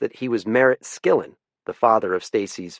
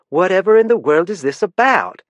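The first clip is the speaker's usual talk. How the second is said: much higher, louder indignant lady; indignant Southern wife; 10 semitones higher, 6 dB louder than usual. Acted. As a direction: sound affronted like a proper Southern wife. Indignant wife questions warden.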